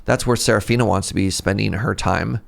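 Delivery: ordinary delivery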